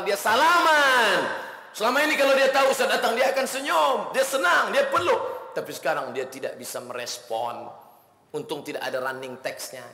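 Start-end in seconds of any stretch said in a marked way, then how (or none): 0.0-5.5 higher and louder, pressing faster; rising fervor. Acted. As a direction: build it up with passion. Preacher building to emotional peak. (penuh semangat, suara meninggi)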